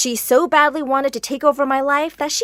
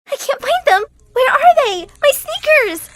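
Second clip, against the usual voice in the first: very high, loud frantic tantrum; girl throwing a fit over missing shoes; huge fit; 7 dB louder than usual. Acted. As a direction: wail for the lost sneakers. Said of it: "She threw a huge fit".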